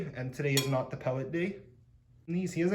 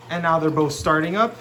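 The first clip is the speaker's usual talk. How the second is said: raised voice over loud bubbles; yelling over noise; 3 dB louder than usual. Real, not acted. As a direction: talk loudly over the pump noise. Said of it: "Sorry, I'm yelling over here".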